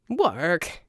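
offended question, rising; indignant. A narrator's reading. Verdigris scoffs at Jack's idea of work, indignant rise. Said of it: "his tone indignant".

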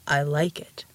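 low, unhurried giant voice; mountain giant. A narrator's reading. The giant replying to Ramona's babbling, deep voice. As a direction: rumble it deep and placid.

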